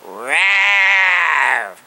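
short high-pitched growl, shouted; a loud playful roar; imitated growl. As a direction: growl it like something scaring a kid. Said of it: "I'm gonna try to imitate it".

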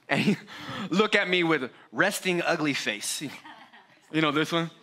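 ordinary talk, nothing marked

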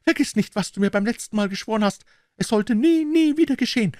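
high, rising desperate plea; pleading woman. Acted. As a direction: beg with growing urgency. Wife pleads with husband.

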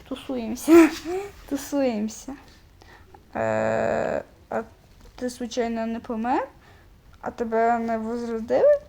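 ordinary talk

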